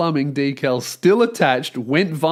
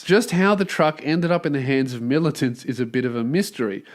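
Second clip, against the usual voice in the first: lower, even reading of the report; news article read; 5 semitones lower, 4 dB quieter than usual. A narrator's reading. Host reads the news story to listeners, dry lower read. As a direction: read the lawsuit report straight.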